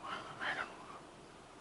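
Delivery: breathy hushed whisper, barely there; a scared whisper in a dark room. Retold or imitated. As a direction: whisper it so Swami won't hear. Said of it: "we had to whisper literally".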